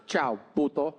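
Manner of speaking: put-on Peruvian accent. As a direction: mom hangs up on her son with an insult. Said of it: "she's from South America, Peru".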